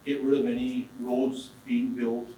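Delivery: usual speaking voice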